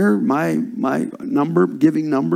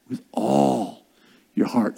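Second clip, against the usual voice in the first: "all" stretched out slowly, dropping softer; stress on ALL; 5 semitones lower, 6 dB quieter, slower than usual. Retold or imitated. Preacher underlining a verse's key word, stress on "all". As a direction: hold "all", then let "your heart" fall low. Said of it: "I underline that word all".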